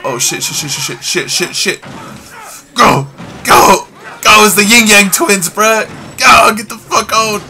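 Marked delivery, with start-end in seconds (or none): none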